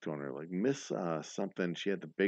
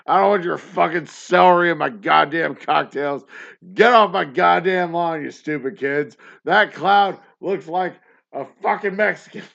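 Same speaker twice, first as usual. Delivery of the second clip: put-on loud, higher cranky old man shouting at kids; 9 semitones higher, 13 dB louder than usual. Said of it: "It's an old [name] yelling at people".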